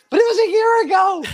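high squeaky play-innocent excuse; mock-innocent protest. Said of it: "And I'm like".

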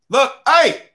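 sudden high, loud holler; calling out sharply. Acted. Veteran guard spots a student in the hallway, hollering. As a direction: yell to stop someone in their tracks.

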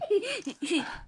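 high bouncy laugh; Tigger chuckle. A narrator's reading. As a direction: giggle in character.

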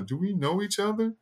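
high, lightly voiced puzzled sister. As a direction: pitch up as a confused woman asking. Sister surprised by stranger.

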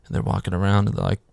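ordinary delivery

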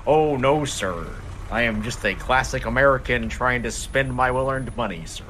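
put-on American accent. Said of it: "[name]'s doing the American accent".

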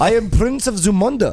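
Eddie Murphy as Prince Akeem; African accent. Retold or imitated. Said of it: "Eddie Murphy".

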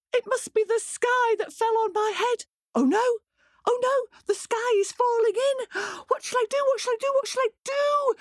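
high, breathless hen in a panic; panicked hen. Acted. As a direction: flap about in a high, frantic voice.

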